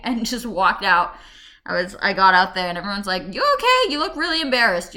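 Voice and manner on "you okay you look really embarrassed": high, louder mock concern; imitated concerned friends. Friends outside the store, high imitated concern. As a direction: ask up high, as worried friends.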